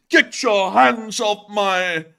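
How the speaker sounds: high, loud, drawn-out mock outrage; hammed-up protest with laughter around it; heavy emphasis. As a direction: bellow it grandly for a laugh. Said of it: "so much emphasis into that last line".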